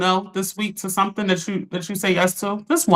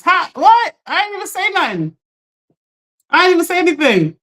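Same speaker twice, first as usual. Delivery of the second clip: high, indignant what, then much higher; surprised protest; 6 semitones higher than usual. Real, not acted. Host answering her mom's accusation in the comments, high and indignant. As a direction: protest your innocence to mom.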